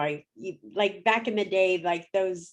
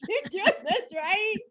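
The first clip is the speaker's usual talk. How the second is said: much higher, tearful and half-laughing; crying while laughing; 11 semitones higher than usual. Retold or imitated. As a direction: relive the tears with a laugh breaking through. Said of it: "I'm crying".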